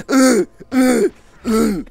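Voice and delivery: loud, high straining grunts; mock grunts. Retelling tongue stuck to can, loud straining grunts. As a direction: grunt as if slamming a tongue on the wall. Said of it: "So I, like".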